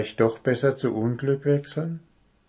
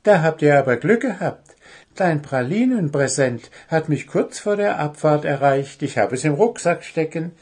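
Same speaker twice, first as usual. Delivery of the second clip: higher, louder, fast and proud; proud elf girl; 6 semitones higher, 4 dB louder than usual. Acted. Elf girl tells friends proudly of pralines. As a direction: boast about the chocolates. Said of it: "sagte Kitty stolz".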